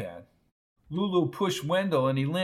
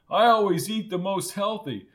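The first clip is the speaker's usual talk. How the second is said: Wendell's voice; 6 semitones higher, 4 dB louder than usual. Acted.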